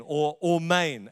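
"ormain" said slowly with an open "or"; "ormain" drawn out carefully; Aramaic pronunciation. Shown as a demonstration. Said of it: "as it should be pronounced".

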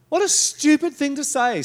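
normal talking voice

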